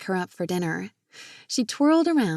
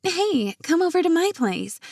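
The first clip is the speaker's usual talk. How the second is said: high-pitched, louder call of an idea; eager invitation; 10 semitones higher, 4 dB louder than usual. A narrator's reading. Jenna inviting Marina over for old movies, eager. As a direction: jump in eagerly, pitch shooting up. Friend has a sudden bright idea.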